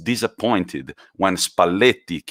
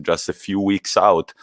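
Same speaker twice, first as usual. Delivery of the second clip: ordinary talk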